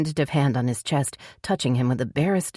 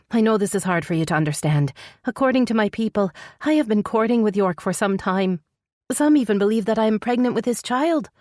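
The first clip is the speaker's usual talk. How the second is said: higher-pitched female character, explaining; high, distressed female character voice; earnest heroine; 7 semitones higher than usual. Acted. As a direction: explain the painful situation in a raised voice. Heroine explains her predicament.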